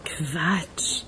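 breathless whispered dismissal; whispered scoff. Acted. Witness brushes off investigator's question in a whisper. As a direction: scoff it under your breath.

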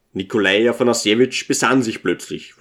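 usual speaking voice

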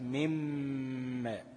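مم ماء stretched very slowly with a long hum before ماء; merged nasal mim. Shown as a demonstration. Teacher demonstrating the merged ghunna in مم ماء. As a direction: hold the humming مم, then open into ماء. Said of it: "وأدغمناها في الميم اللي بعدها".

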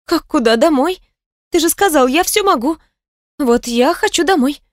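high-pitched heroine, pressing her point; defiant young woman in the story. A narrator's reading. Woman telling her captor she wants home, high and defiant.

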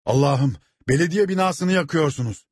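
high, alarmed cry; thundering outcry. A narrator's reading. Steward sees the town hall burning, crying out. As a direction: exclaim in horror at the sight. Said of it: "diye gürledi".

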